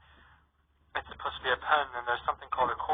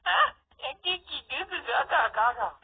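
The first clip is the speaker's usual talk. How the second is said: put-on very high, slow baby babble; the baby sister babbles her two cents on hiccups; 14 semitones higher, 4 dB louder, much slower than usual. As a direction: coo it like a toddler.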